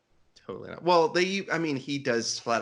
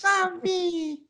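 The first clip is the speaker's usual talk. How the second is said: very high, pleading little voice; high-pitched playful baby voice; cute baby voice; 3 dB louder than usual. Pretend. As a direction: beg for love in a high cutesy squeak. Voicing the adorable baby in picture.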